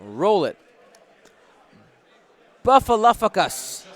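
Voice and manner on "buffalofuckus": loud, higher, announcing a silly name; called-out name. Real, not acted. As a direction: belt out the winner's name like a host. Announcing winner's odd name.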